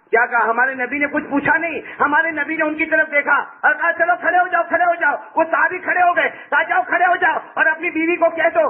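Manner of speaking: high, ringing sermon delivery; full-force preaching. Acted. Preacher retelling the Prophet's command to a companion, impassioned and high. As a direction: preach it high, pushing line after line.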